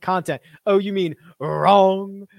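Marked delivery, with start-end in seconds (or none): 1.4-2.3 put-on "wrong" stretched slowly in Mark's quirky accent, a touch louder. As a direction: drop "wrong" in Mark's odd accent as a running joke. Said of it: "Mark's wrong accent".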